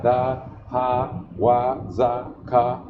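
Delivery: ordinary talk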